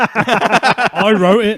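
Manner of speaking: laughing through the words. Real, not acted. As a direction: say it while laughing. Laughing admission during banter.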